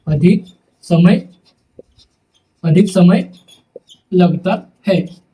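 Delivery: word by word with long pauses, for writing down; slow dictation. Shown as a demonstration. Teacher dictating notes word by word to students, slowly. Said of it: "dictating definition word by word".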